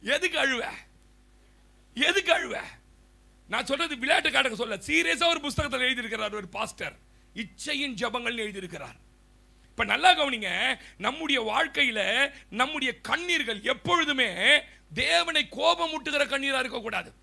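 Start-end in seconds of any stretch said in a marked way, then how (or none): 1.9-2.6 எதுக்கு pushed high and loud; louder rebuke. Acted. Preacher repeats the rebuke. 9.7-17.1 loud, rising sermon climax, slow in places; preaching. Acted. Solemn sermon point.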